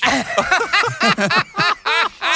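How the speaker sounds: long high burst of laughter; high delighted laugh; big laugh. Real, not acted. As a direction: crack up at your own story. Punchline of [name] story.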